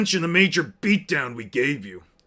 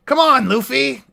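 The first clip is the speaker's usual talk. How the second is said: higher put-on Usopp whine; attempted Usopp voice; 8 semitones higher, 5 dB louder than usual. Shown as a demonstration. Pleading with Luffy, higher Usopp voice. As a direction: pitch it up like Usopp. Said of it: "Yeah, I gotta".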